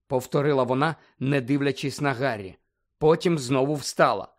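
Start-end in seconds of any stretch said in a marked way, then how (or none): none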